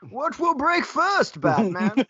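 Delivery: high, slow, strained villain voice; overdone masked-villain impression; Bane voice. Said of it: "the voice is so weird".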